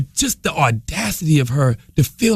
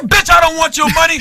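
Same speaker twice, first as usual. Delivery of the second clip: loud, high angry shout; angry retort; 12 semitones higher, 6 dB louder than usual. Retold or imitated. Customer yelling back at the nervous lady. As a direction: tell her off hard. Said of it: "I said".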